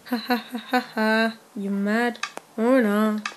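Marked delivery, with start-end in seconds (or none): 0.1-1.4 mock laugh.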